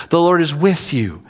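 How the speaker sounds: ordinary talk, nothing marked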